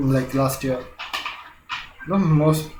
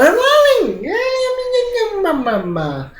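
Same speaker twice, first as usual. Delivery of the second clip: squeaky high mock panic. Pretend fleeing after joke.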